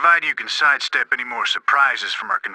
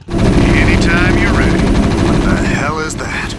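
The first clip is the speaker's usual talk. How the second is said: battle call; 5 semitones higher, 10 dB louder than usual. Acted. Marine calls out in battle.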